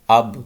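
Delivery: اب with the final b released in a rebound; bouncing qalqala. Shown as a demonstration. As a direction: make the b of اب echo back. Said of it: "آواز لوٹ رہی ہے، repeat ہو رہی ہے".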